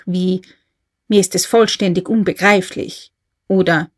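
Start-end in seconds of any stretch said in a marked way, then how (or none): none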